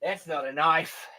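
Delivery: lightly voiced Dundee, "That's not a knife" said flat and dismissive; a hint of the Aussie knife line; Crocodile Dundee impression. Host doing the Dundee line over the box cutter, lightly voiced. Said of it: "that was another one".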